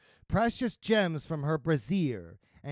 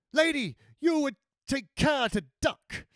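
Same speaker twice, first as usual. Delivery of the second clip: high-pitched mercenary nun giving warning; Murta's character voice; 10 semitones higher, 4 dB louder than usual. A narrator's reading. Mercenary nun warning the lady to duck, high voice.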